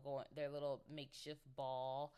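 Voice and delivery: ordinary delivery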